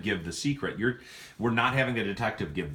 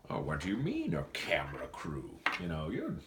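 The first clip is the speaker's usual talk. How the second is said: softly feigning innocence; quiet, swooping pitch of mock concern; Brentford; 4 semitones lower, 8 dB quieter than usual. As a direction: play dumb, soft and puzzled.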